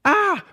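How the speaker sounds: short, very high yelp; mock scream. Moses is told to pick up the snake, mock scream. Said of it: "And Moses is like".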